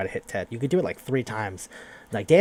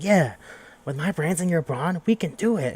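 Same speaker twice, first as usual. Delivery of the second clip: nerdy glee; 6 semitones higher than usual. Retold or imitated. Nerd leader Ernest gleefully agreeing.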